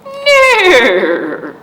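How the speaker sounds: put-on whinny like a pony for the kids; horse neigh. Shown as a demonstration. Librarian showing kids a horse's sound, loud neigh. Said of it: "What sound does a horse make?".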